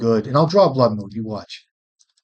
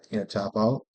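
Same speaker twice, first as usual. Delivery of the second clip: ordinary talk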